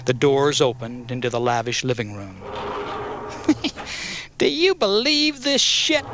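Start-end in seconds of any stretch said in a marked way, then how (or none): 2.4-4.3 wheezy snickering cackle. A narrator's reading. One of Wolf's men cackling at the lavish room. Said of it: "cackled one of the men". 4.4-6.0 very high cackling squawk. A narrator's reading. Wolf's henchman marvelling at the lavish room, cackling. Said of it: "cackled one of the men".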